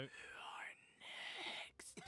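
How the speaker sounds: menacing hushed whisper; Nala's whisper voice. A narrator's reading. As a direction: threaten quietly with a full mouth. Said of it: "as Nala says with lobster dripping".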